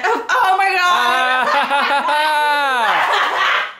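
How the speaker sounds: long drawn-out laughing shriek of surprise; real surprise, stretched out and laughing; startled. Real, not acted. Spotting something on the ceiling, drawn-out laughing surprise.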